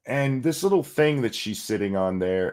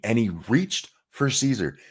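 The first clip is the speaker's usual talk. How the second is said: normal talking voice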